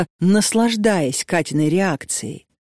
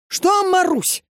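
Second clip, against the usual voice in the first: much higher, cocky challenge; cocky retort; 13 semitones higher, slower than usual. A narrator's reading. Marusya retorting cockily to the old woman. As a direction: snap back, spoiling for a fight. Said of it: "задиристо спросила та".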